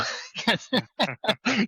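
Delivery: a squeaky laugh breaking out; high burst of laughter. Real, not acted. Laughs at his own story.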